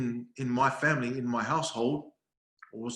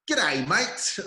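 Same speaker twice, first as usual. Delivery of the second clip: loud, high-pitched mock Aussie greeting; mock Australian accent; 7 semitones higher, 6 dB louder than usual. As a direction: tease with an exaggerated Australian accent. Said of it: "Your accent, man. You're bloody Australian".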